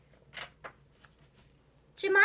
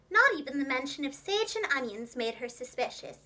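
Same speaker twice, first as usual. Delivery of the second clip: ordinary delivery, nothing marked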